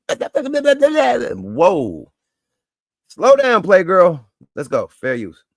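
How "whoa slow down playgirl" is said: high, loud mock alarm; mock shout. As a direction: yell it high like a wary ref. Mock exclaiming at man.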